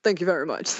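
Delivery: lightly voiced rock-and-roll legend. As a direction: toss off a quick mock stage thanks. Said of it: "doing an [name] impression".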